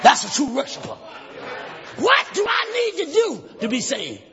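forceful shouted sermon with rhythmic pauses; full-force preaching. Acted. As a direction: declaim the story to the congregation.

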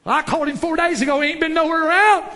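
loud, high-pitched protest building to a shout; distressed complaint. Acted. Caller who has waited four days, distressed and loud. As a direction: push the frustration up to the last word.

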